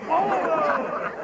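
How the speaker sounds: slow drawn-out chorus of pupils; class chorus. Retold or imitated. Class blaming Oliver in slow chorus. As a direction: drag the name out like a whole class.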